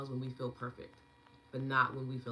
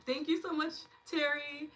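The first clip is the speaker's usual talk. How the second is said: much higher, bright thanks; high excited thanks; 11 semitones higher, 3 dB louder than usual. Real, not acted. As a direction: lift it way up happily.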